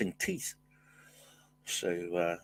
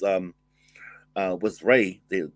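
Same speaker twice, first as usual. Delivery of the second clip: ordinary talk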